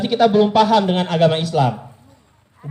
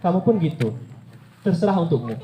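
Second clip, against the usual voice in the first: quiet calm reply; 4 semitones lower, 6 dB quieter than usual. Retold or imitated. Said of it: "Nabi Muhammad cuma bilang".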